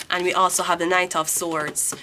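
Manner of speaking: ordinary talk, nothing marked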